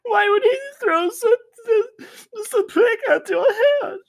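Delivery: over-the-top fake weeping, voice cracking high; hammed-up sobbing. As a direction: wail it like an overacted crybaby. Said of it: "I start crying".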